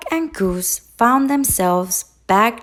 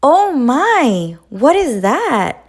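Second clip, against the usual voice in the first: high, loud, surprised duck; excited duck voice; 6 semitones higher, 6 dB louder than usual. A narrator's reading. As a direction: gasp it out as an eager duck. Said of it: "Duck quacked".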